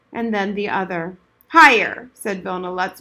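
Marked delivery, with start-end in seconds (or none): 1.5-2.1 barked command. Acted. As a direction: drill sergeant command. Old soldier commands.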